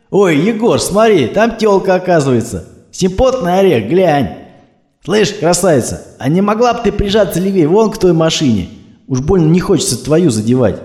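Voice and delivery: swaggering tough guy barking from his jeep; cocky thug. Acted. Thugs ogle a woman driver.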